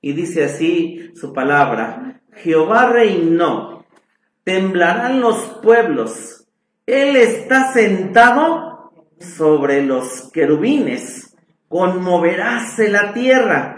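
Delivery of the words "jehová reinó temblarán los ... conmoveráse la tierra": slow, raised, higher preaching read; solemn scripture reading. Acted. Preacher reading the psalm to his congregation, slow and raised. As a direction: proclaim the psalm slowly to the congregation.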